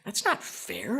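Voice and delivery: soft, hesitant muttered thought; hesitant inner thought. Retold or imitated. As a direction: think it aloud quietly, with a small grumble. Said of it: "and I thought".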